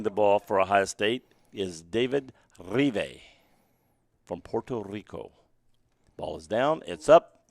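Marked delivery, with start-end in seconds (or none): none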